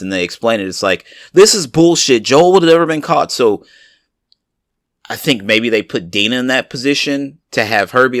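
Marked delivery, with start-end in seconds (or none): 1.3-3.3 outraged fan. Retold or imitated. Fans voiced as outraged.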